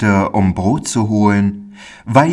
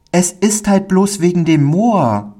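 put-on high-pitched timid child voice; timid little boy; 9 semitones higher than usual. A narrator's reading. Pauli explains to the stranger what scares him, timid high voice. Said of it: "wieder sah er sich furchtsam um".

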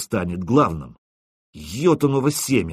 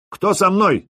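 rallying call; 9 semitones higher, 4 dB louder, slower than usual. Acted. Calls men to follow him.

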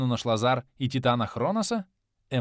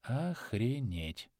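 soft, slow stunned whisper; awed whisper at the sight; 7 dB quieter, much slower than usual. A narrator's reading. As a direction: breathe it out in shock. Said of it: "ошеломлённо прошептал Плинта".

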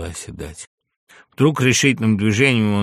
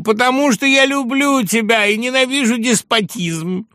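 high, loud declaration; heated, high-pitched outburst; impassioned shout; 8 dB louder than usual. A narrator's reading. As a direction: proclaim it fervently in a raised voice. Agitated friend shouts a declaration.

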